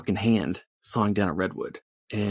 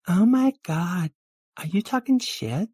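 put-on high, drawn-out girly outrage; high-pitched slow "oh my God"; gushing valley girl, affronted; 10 semitones higher, 4 dB louder than usual. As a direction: play an affronted girlfriend, pitched up.

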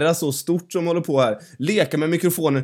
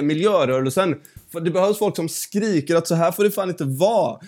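ordinary delivery

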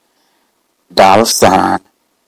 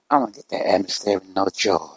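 normal talking voice

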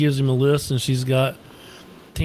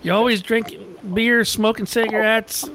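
nagging wife; 7 semitones higher than usual. Retold or imitated. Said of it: "starts giving him a rash of shit".

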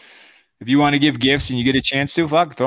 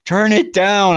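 raised, high mock-wife voice; retold irritated order; 7 semitones higher, 5 dB louder, slower than usual. Wife woken by noise orders husband, loud and irritated. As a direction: imitate a wife calling out an order.

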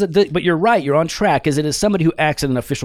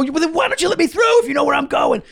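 much higher, shouted in disbelief; exasperated shout. Retold or imitated. Pastor shouting at a cop who won't let him through, exasperated. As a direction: push back hard, voice cracking up high.

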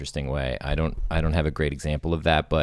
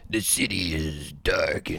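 brooding noir narrator; slower than usual. Pretend. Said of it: "He's not Batman".

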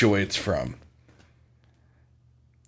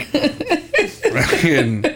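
ordinary delivery

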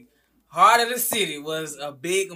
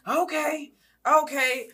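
excited okay; 10 semitones higher, 3 dB louder, much slower than usual. Retold or imitated. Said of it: "And I'm like".

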